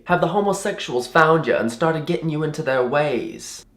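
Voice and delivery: high put-on Southern lady voice; mock Southern concern; Southern accent. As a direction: imitate a Southern woman earnestly prying. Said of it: "really bubbly and talkative and Southern".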